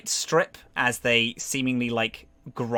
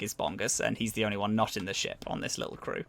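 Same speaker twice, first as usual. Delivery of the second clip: ordinary talk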